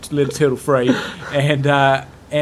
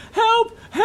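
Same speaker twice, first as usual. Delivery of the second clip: much higher mock cry for help, half laughing; retold high-pitched yell for help; imitating a lady calling for help. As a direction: shriek it like a woman stuck up on a balcony. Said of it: "we hear this lady going".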